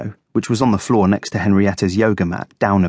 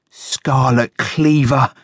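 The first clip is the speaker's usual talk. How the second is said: slow, savoured announcement, pitched up; drawn-out, delighted reveal; gleeful; 4 semitones higher, much slower than usual. A narrator's reading. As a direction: reveal it slowly with glee. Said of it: "rubbed his paws together in glee".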